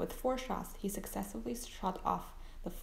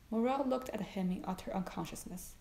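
ordinary delivery

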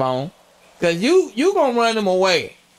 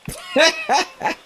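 high laugh; 11 semitones higher than usual. Real, not acted. Laughing at the robot voice.

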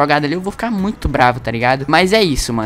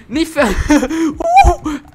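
laughing yelp; 9 semitones higher, 6 dB louder, much slower than usual. Real, not acted. Laughing cry while losing.

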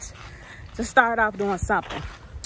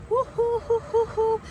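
playful whoop; 13 semitones higher than usual. Real, not acted. Cheering sign-off.

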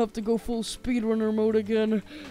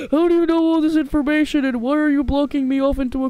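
loud, high, rushed questioning; fast, raised-voice protest; alarmed protest; 5 semitones higher, 6 dB louder, faster than usual. Acted. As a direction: blurt it out in rising panic. Cornered, demands answers from the character.

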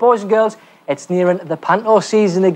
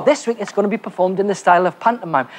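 normal talking voice

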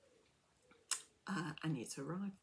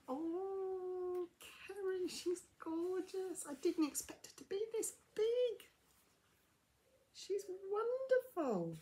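overflow with delight at the gift; gushing delight; 11 semitones higher than usual. Real, not acted. Thanking her friend Kerry for the toy, high gushing delight.

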